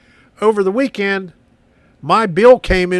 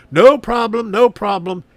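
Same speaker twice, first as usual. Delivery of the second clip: lightly imitated Indian accent, raised pitch; 5 semitones higher, 5 dB louder than usual. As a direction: retell it with a hint of Indian accent. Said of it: "in an Indian accent".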